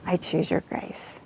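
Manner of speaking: hushed whisper to God; retold whisper of surrender to God; whispered prayer. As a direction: breathe the words as a surrendering prayer. Prayer answering God, reaching up.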